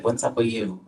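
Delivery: usual speaking voice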